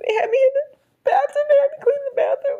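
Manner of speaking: very high, fast mock wail; imitating crying while explaining to mom; tearful excuse.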